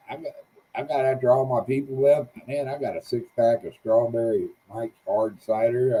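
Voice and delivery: usual speaking voice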